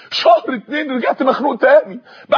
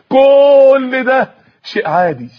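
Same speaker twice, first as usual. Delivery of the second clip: higher, louder, slowly drawled excuse; drawn-out dismissal; 4 semitones higher, 5 dB louder, much slower than usual. Acted. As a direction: stretch the first word long and high. Sarcastic excusing of his ordinary life. (استخفاف بكلمة ممدودة)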